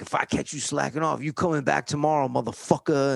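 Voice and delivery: a hint of a menacing foreman; foreman's threat. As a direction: read him the riot act, quick. Foreman reads riot act.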